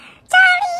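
loud, very high cutesy squeal; hammed-up high, sweet calling; imitating cute calling. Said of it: "ทำไมกูพูดไม่น่ารักเหมือนเขาวะ".

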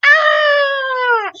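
high, loud panicked scream; loud scream of fright. Acted. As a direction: let out a big scared yell. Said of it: "Il crie".

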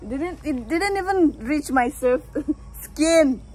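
loud laughing protest; laughing complaint. Real, not acted. Complaining about the kiss.

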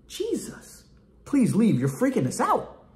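mock-fearful pleading, starting hushed and high; afraid. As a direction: beg him timidly to go away. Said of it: "because they were afraid".

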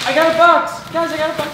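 high loud happy announcement; excited shout. Real, not acted. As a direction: shout it out with glee. Excited about gift.

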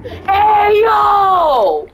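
sudden high yell; shouting. Real, not acted.

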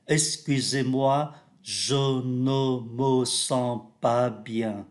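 "je ne me" with rounded lips, close to o; "je ne me" said slowly with rounded lips; rounded e sounds. Shown as a demonstration. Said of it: "Ba cái âm này chúng ta phải tròn miệng lại".

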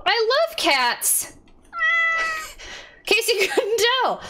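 high-pitched gushing; giddy, very high voice; delighted squeal. Real, not acted. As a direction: squeal with delight like a cat lover. Delighted to find cats everywhere.

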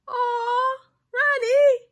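high, loud, gushing aww; touched and delighted. Real, not acted. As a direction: coo it loudly, moved by the card. Touched by partner's card.

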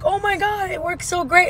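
high, gushing excitement about the pump; excited squeal. Retold or imitated. Friend texting her delight about the pump, retold with high excited squeal. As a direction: squeal it like great news. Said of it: "she texts me in excitement".

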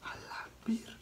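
hushed swearing in shock; voiceless, awestruck curse under the breath; stunned whisper. Real, not acted. Stunned after the crash scream.

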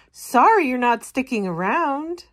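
a plant seeing Sprout off; lightly voiced woodland plant; woods plant farewell. Woods plant saying goodbye.

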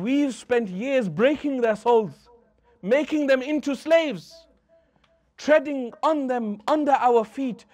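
slow, high astonished retelling; shocked awe. Retold or imitated. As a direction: voice Urwa rising in amazement at what he sees. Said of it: "Urwa bin Mas'ud is shocked".

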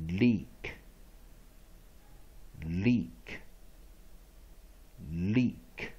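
"Leak" alone with a long ee and final k; practice word. Shown as a demonstration. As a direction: model "Leak" as a single, separate drill word. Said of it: "再做一次练习".

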